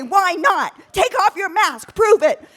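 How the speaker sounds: loud, high challenge; shouting a dare at a hiker; angry shout. Retold or imitated. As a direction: dare her to prove it. Shouting at each other.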